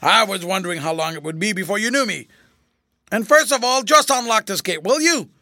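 loud, high, pushy voice; cocky. A narrator's reading. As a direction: order him about, rising sharply. Tramp now bossing the general.

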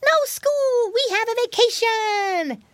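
very high, loud piggy squeal. A narrator's reading. Piggy boy tells rabbit uncle the news, squealing. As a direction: squeal the news like an overjoyed child. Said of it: "squealed Curly".

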